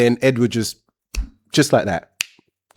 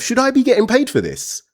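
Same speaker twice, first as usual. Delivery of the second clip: incredulous; 14 semitones higher than usual. Retold or imitated. Thinking during the odd encounter.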